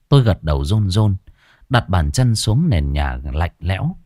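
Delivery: plain reading voice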